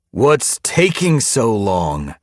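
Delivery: loud, high, very slow; impatient demand. Acted. Impatient leader follows them out.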